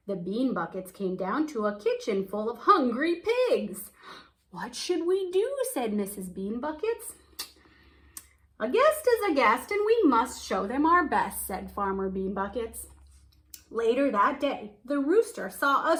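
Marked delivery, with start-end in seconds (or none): 4.5-5.5 worried mother. Acted. Mrs Bean Buckets worries aloud. 8.6-11.5 hearty farmer. Acted. Farmer states his motto.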